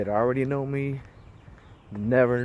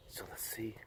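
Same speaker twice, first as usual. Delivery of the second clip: whispering; 25 dB quieter than usual. Real, not acted. Whispering while starting walk.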